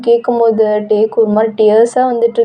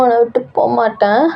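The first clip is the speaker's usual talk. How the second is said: plain reading voice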